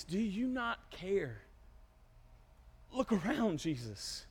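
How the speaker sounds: soft, drawn-out appeal; subdued plea. Acted. Disciples awakening Jesus, hushed pleading. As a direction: plead quietly, letting the name drop away. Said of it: "Awakening Jesus".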